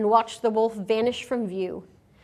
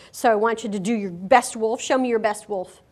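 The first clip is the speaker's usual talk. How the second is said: normal talking voice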